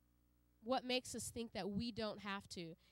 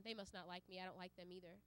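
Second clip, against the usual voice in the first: sulky mutter; 6 semitones lower, 12 dB quieter, faster than usual. Pretend. Voicing someone holding grudge.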